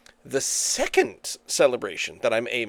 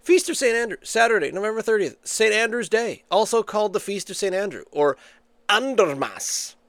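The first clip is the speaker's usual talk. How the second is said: reading aloud; 6 semitones higher than usual. Acted. Reading search result aloud.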